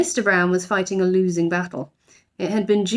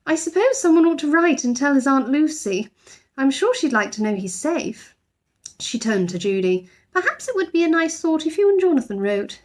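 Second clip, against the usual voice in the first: Mrs Brown suggesting; 7 semitones higher than usual. A narrator's reading. Mother character in story.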